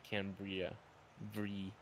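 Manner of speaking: Cambria with a clear 'bree', then Brie isolated softly; misheard pronunciation. Retold or imitated. Host explaining what he thought he heard, CAN-bree-uh pronunciation. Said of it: "I thought he was saying".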